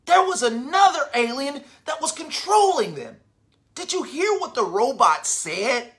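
plain reading voice

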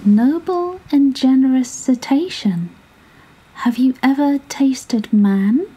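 a small clever fish flattering the whale; small 'stute voice. A narrator's reading. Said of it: "in a small 'stute voice".

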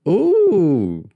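high, loud delighted ooh; cooing at a baby. A narrator's reading. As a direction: melt over a cute baby. Lauren first sees baby Joshua.

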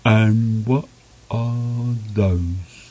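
very slow, wondering snowman; slow puzzled snowman. A narrator's reading. Snowman asking about the bears, very slow. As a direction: draw the question out in wonder. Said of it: "he said".

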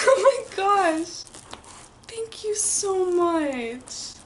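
high, tearful voice breaking into sobs; choking up with real tears, drawn out slow; crying. Real, not acted. Opening a friend's gift on camera, crying with real tears. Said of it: "guys, I'm gonna cry".

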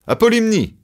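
loud, high chant of protest; the gods jeering at Zeus; mocking shout. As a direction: call out the cheat. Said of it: "crièrent les dieux".

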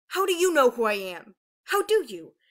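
loud, heated retort; angry challenge. A narrator's reading. Aurora to her pleading sister, angrily challenging. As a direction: throw the question back hard.

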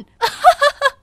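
high, loud mocking cackle; loud laugh. Mother laughs scornfully at Emma, a loud high cackle. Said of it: "แม่ฉันก็หัวเราะลั่น".

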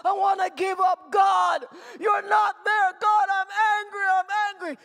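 shouted protest to God; hurting, angry cry. Acted. Believer venting anger at God, shouting. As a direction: cry out at the end of endurance. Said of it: "when we're hurting so much that we can say".

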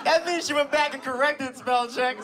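usual speaking voice

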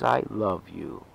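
ordinary delivery

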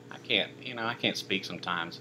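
tripping over words; stumbling, halting speech; stuttering. Real, not acted. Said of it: "I'm just stuttering".

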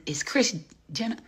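normal talking voice

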